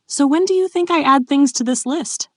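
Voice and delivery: high, playful lilting question; teasing. A narrator's reading. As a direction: tease with mock curiosity. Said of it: "she teased".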